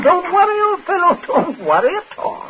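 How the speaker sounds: high, breezy mock reassurance; falsely cheerful. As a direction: laugh it off cheerily.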